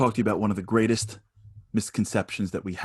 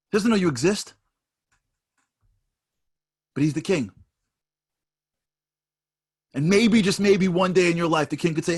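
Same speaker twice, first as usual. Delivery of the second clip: building preacher's fervour, higher and louder; rising sermon; 7 semitones higher, 5 dB louder than usual. Acted. Preacher to the congregation, climbing to full fervour. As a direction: preach it bigger and higher line by line.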